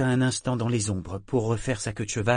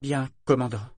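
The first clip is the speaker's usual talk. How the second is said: despairing; 3 semitones higher than usual. A narrator's reading. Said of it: "fit-elle désespérée".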